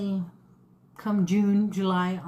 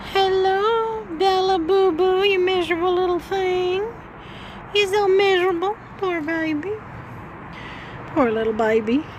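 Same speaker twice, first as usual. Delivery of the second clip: high, loud pitying coo; 9 semitones higher, 4 dB louder than usual. Real, not acted. Cooing to pet Bella.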